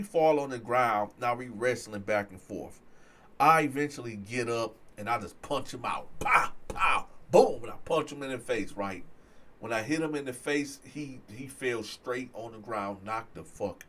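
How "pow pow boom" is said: punchy comic-book hit noises; loud final boom, storyteller's sound effects; imitated punches. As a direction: fire off each blow as a burst. Imitates his own punches landing.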